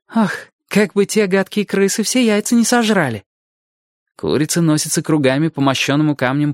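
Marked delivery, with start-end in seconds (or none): 0.1-3.2 put-on high, lively young girl voice; girl's voice. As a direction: pitch it up as a fretting farm girl.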